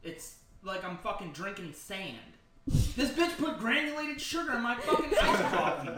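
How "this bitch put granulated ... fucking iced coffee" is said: mock outrage. Real, not acted. Outrage at sugared coffee.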